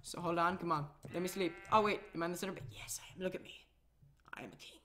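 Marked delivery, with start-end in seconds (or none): 2.5-4.8 a soft, pleased whisper to himself; whispered boast. Pretend. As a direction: whisper it like a smug little secret. Lying in the center bed.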